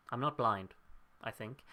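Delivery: ordinary talk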